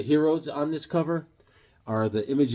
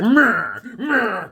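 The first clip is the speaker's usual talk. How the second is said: mock angry grumbling noise, raised and loud; Hawkeye; 6 semitones higher, 5 dB louder than usual. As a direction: imitate a sulking hero's wordless tantrum. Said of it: "Hawkeye is all mad".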